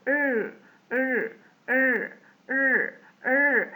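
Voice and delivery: long sustained English R for "ر"; tongue-held R. Shown as a demonstration. Teacher demonstrating letter R, long held "ر". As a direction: hold "ر" with the tongue hung up. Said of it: "لساننا متعلق فيه".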